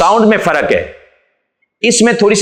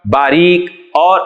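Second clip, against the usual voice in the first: ordinary talk, nothing marked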